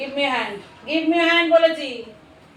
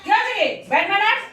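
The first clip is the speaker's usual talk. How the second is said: mock scolding; 6 semitones higher than usual. Real, not acted. Owner tells dog off for eating.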